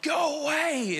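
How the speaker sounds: very high-pitched retold holler; mock yell. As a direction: call out as if to pursuers, without full volume. Retold yelling at chasing police.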